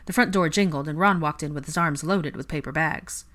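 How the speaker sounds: ordinary delivery, nothing marked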